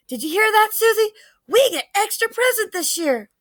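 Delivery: high-pitched, gleeful boast to a sister; eager child's lifted voice; excited crowing. A narrator's reading. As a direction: crow the good news across the table. Child crows to sister about presents.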